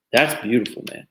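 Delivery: normal talking voice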